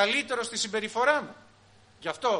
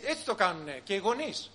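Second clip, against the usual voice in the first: ordinary talk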